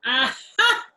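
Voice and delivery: laughing aha, caught you; triumphant aha. Acted. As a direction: crow it out with a laugh. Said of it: "she cried".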